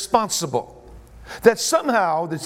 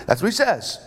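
ordinary delivery

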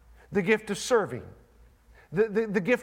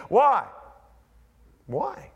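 ordinary delivery

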